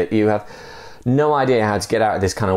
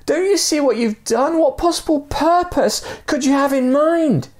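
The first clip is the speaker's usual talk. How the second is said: high-pitched exasperated mock shout; high, pleading shouts down to the dangling man; storytelling shout. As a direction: fire the questions down at him. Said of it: "shouted to the guy".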